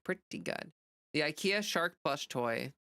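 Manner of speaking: ordinary talk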